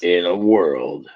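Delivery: low, slow trailer announcer; movie-trailer voice. Acted. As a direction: intone it like a film trailer. Mimicking a movie trailer opening.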